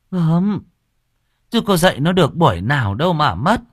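mocking mother. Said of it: "mother retorts".

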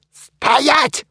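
sharp commanding shout. A narrator's reading. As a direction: bark the order. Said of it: "прикрикнул Мумий".

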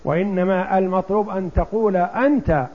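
ordinary delivery

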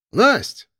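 sharp calling out a name. Acted. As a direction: call her name across the stairwell. Зовёт девушку в подъезде. (окликнуть по имени)

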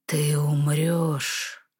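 low, very slow dragon hiss; hissing reptile growl. Acted. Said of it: "прошипел дракон".

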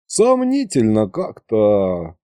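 slow, doubtful drawl; drawn out in doubt; thoughtful drawl. Acted. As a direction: stretch it out, weighing it up. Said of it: "в раздумье протянул я".

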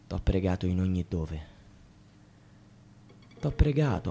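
very soft, low, nearly vanishing voice; defeated murmur. Acted. Man whose prayers failed, defeated murmur. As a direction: let the prayer die away in defeat.

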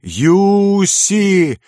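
long, high, swelling cry of a name; drawn-out anguished scream; sister's wail. A narrator's reading. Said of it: "разрастался вопль сестры".

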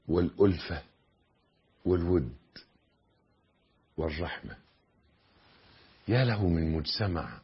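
low, quiet sermon voice with long pauses; hushed, reflective exhortation; soft solemn preaching. Real, not acted.

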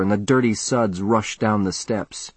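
ordinary delivery, nothing marked

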